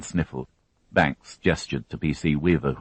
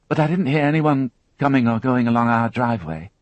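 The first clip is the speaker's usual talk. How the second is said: put-on higher, tense young woman recounting an ordeal; higher, strained witness voice; Alison, shaken young witness; 8 semitones higher, 4 dB louder than usual. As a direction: voice her as a frightened girl holding it together. Alison on gun, cars.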